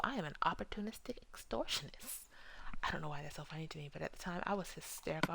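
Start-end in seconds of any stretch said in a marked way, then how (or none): 0.0-2.3 proud whisper. Retold or imitated. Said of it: "I was like".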